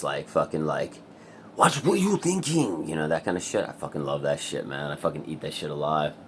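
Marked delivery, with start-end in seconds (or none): 1.6-2.9 slow, high, hammed-up stage delivery; theatrical line reading. Shown as a demonstration. Showing off his old theatrical TikTok acting, slow and high. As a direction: overact it like a melodrama. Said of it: "like, theatrical and just".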